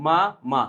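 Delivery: মা, মা said as two separate beats; syllables split apart. Shown as a demonstration. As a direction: break মামা into মা and মা. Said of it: "মামাকে যদি উচ্চারণ করতে যাই". (মা আর মা আলাদা করে বলা)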